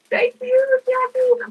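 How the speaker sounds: high, bright-eyed thank-you; gushing thanks to a viewer; excited thanks. Real, not acted. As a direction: squeal the thanks in delight.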